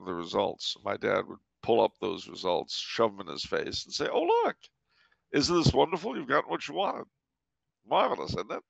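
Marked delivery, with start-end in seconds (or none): 4.1-8.6 sarcastic cheer, pitched much higher; mock delight. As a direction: rub his nose in it with fake delight. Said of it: "That was how he played it".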